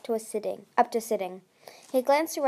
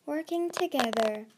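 usual speaking voice

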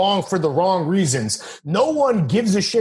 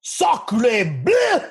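hammed-up French accent, pitched up; french chef; 7 semitones higher than usual. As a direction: toss it off like a stage Frenchman. Mock French exclamation.

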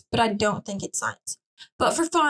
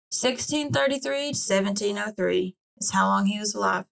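ordinary talk, nothing marked

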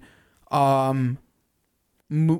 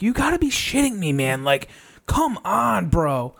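incredulous outburst; 5 semitones higher than usual. Retold or imitated. Hen pulls birds away again.

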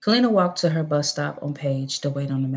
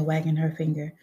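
ordinary delivery

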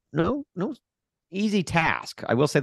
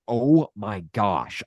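slow, lower, exasperated groaning words; retold fuming; 5 semitones lower, much slower than usual. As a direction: reenact seething disbelief, drawn out. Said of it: "I was fuming at this point".

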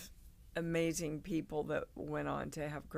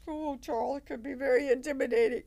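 higher, fast, nervous-sounding protest; flustered excuse; 6 semitones higher, 5 dB louder, faster than usual. Retold or imitated. Young actress answering her stern teacher, fast flustered pleading. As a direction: rush the excuse out, a bit higher and breathless.

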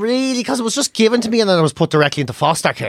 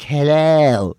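lower, put-on posh greeting; 5 semitones lower than usual. Son mimicking his ma when she leaves the house, posh voice. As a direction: greet like a mother putting on airs. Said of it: "she goes out and she's like".

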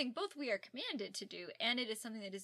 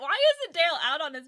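ordinary delivery

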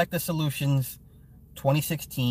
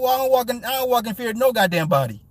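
ordinary talk, nothing marked

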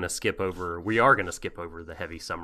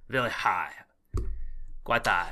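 put-on Viking accent; 4 semitones higher than usual. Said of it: "authentic Viking impression".

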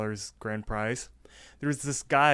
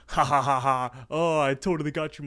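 acted gloating ha-ha, pitched up; mocking laugh; 3 semitones higher, 4 dB louder than usual. Raffle announcer pranking the winner, laughing mockingly. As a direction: laugh at the victim, then gloat.

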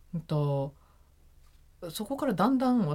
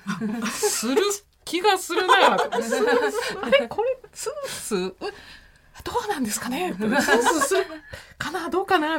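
high, laughing, unsure answer; half-laughing, lost and unsure; confused, unsure; 11 semitones higher, 5 dB louder than usual. Retold or imitated. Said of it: "なんかよくわかんない答えしちゃって".

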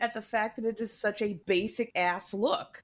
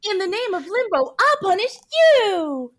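put-on bright magical girl; 11 semitones higher, 8 dB louder than usual. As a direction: belt it like a Sailor Moon transformation. Sailor Moon catchphrase parody.

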